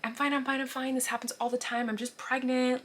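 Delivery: high, insistent retold protest; apologetic reassurance. Embarrassed pregnant passenger waving off the doctors, apologetic insistence. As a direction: replay brushing off concern, pitched well up. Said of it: "I was just apologizing and saying".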